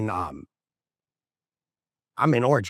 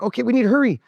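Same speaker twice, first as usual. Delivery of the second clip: urgent hurrying; 10 semitones higher, 5 dB louder, much faster than usual. Retold or imitated. Grandson urging grandma to hurry.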